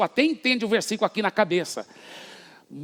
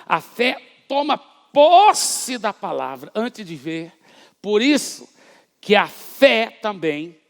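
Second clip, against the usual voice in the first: slow, emphatic preaching with pauses; preacher hammering home a point on faith; 4 dB louder, much slower than usual. Real, not acted. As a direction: drive each phrase home slowly. Preaching at full force.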